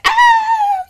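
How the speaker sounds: very high, loud joyful "Ah!"; exuberant cry. Shown as a demonstration. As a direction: shout out pure exuberance. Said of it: "that's more like exuberance".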